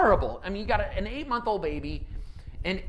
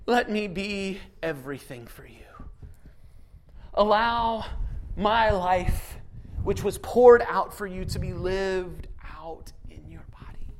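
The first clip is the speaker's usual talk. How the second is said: gentle hushed plea; slower than usual. Acted. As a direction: invite them tenderly. Voicing Jesus softly.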